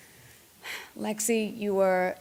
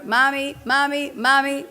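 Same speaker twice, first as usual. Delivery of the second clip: louder, higher mock kid calls; louder child calling Mommy; 6 semitones higher, 7 dB louder, slower than usual. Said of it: "Even when it gets louder".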